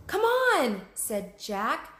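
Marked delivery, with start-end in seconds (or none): 0.1-0.9 much higher, louder urging; urgent call. Acted. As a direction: push her on with urgency. Jack urges Annie.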